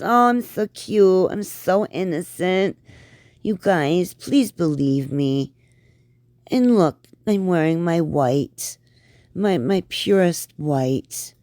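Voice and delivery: high, slow, simpering fake-innocent voice; mock innocent voice. Reaction host mimicking the vlogger's innocent act, high simpering mock voice. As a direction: parody her sugary "so innocent" act.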